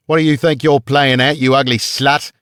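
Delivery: high, loud Australian guard yelling; furious Australian guard shouting at a kneeling woman. A narrator's reading. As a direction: bellow it in an Australian accent. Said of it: "he shouted in an Australian accent".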